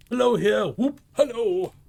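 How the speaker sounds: high, loud gleeful calling out; joyful whoop. Acted. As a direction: whoop it like a boy on a holiday.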